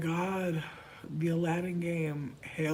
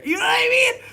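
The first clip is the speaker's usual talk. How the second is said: excited squeal; 10 dB louder, much faster than usual. Real, not acted. Ranting about aqua signs.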